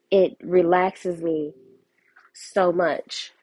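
usual speaking voice

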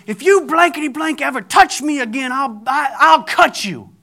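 high, loud wife's outburst; angry threat. Retold or imitated. Wife threatening her husband on the train, angry. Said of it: "She's saying".

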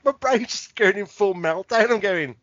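ordinary talk

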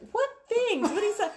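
very high, incredulous mock questioning; baffled questioning. Mom questioning daughter about the things, high disbelief. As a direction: squeak it in disbelief.

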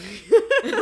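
loud, high laugh breaking out; real burst of laughter. Real, not acted. Laughs recalling the steep road.

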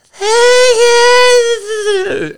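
put-on high, drawn-out drunk voice; imitating a drunk friend in the car. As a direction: play the tipsy friend. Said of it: "she's hilarious when she's drunk".

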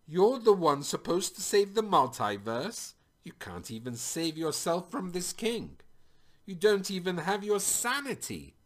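high, loud, acted anguish; anguished outburst. A narrator's reading. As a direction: throw it out in anguished disbelief. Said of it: "anguished Nat".

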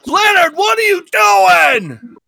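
high, loud, slow shriek of a name; furious woman shrieking; screaming. Acted. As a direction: shriek it across the road. Hillary screams at Leonard.

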